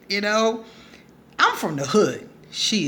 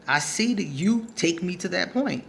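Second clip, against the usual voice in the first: usual speaking voice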